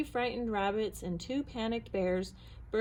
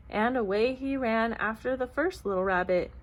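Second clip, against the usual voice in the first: usual speaking voice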